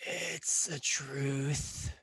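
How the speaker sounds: slow, drawn-out rasp; rasping, wheezing creature. Acted. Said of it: "rasping, wheezing voice".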